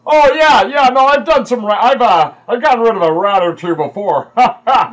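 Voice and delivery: loud, high, hearty character voice; boisterous Bron. Acted. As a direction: boom it out eagerly, ending in a big laugh.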